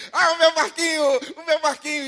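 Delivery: high, loud cry of joy at finding his boat; a child overjoyed to find his little boat again; excited boy. Acted. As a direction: voice the boy spotting his lost toy, thrilled. Boy finds his lost boat.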